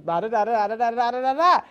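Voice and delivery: mock Russian babble. Parent babbling like a Russian woman, Russian accent. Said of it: "We're that Russian woman going".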